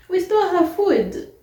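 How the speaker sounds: high and loud, stole stretched in disbelief; incredulous outburst. Retold or imitated. Repeating the roommate's absurd accusation, incredulous and loud. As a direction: throw it back like you can't believe it.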